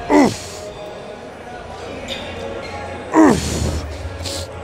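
effortful oof under load; straining grunt. Real, not acted. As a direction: grunt through the rep. Straining mid-rep.

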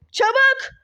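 high, sharp impatient order; impatient command. Acted.

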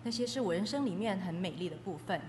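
usual speaking voice

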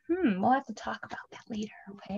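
fast hushed aside; whisper. Real, not acted. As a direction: whisper it quickly. Unvoiced whisper.

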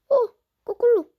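loud, high excited cry; excited yell. Real, not acted. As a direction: shout it out in excitement. (신나서 외치는 소리)

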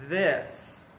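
ordinary delivery

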